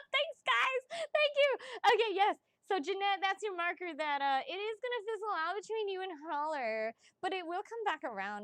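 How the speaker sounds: bubbly and bright after laughing; giddy excitement. Real, not acted. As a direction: ride the high of the laugh.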